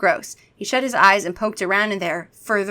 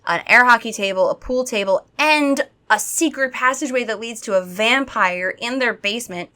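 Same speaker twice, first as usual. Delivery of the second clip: ordinary delivery, nothing marked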